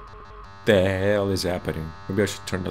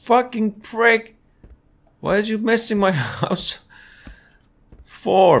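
furious yelling; 10 semitones higher, 5 dB louder than usual. Real, not acted. Streamer yelling furiously at the intruder in the game. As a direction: rage at whoever wrecked the house.

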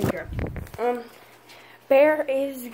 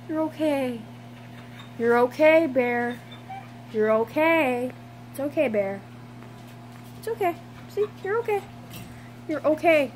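higher, sing-song reassurance to a dog; soothing pet talk; 4 semitones higher than usual. Real, not acted. Owner comforting scared foster dog Bear, high soothing pet voice. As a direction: coo it like you're calming a frightened puppy.

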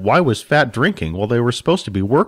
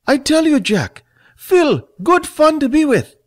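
much higher and louder, squeaky and merry; a small drunk man gushing over his friend; Fat, drunk and jolly; 4 dB louder than usual. Acted.

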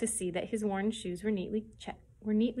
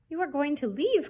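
higher, louder dismayed cry; 7 semitones higher, 4 dB louder than usual. A narrator's reading. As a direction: blurt it out in alarm. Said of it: "cried Susie".

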